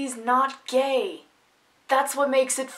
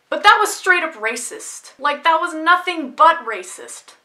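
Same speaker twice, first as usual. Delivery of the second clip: high, loud and emphatic; indignant outburst; 6 semitones higher, 7 dB louder than usual. Real, not acted. Calling out the racist joke.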